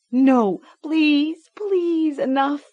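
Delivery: high, loud begging; begging child. A narrator's reading. Girl begs the doctor to stop, high desperate begging. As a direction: beg them to stop.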